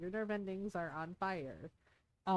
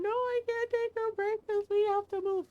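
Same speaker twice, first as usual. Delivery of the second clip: crying protest; 12 semitones higher, 5 dB louder than usual. Retold or imitated. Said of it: "I'm crying".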